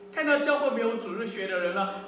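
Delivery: normal talking voice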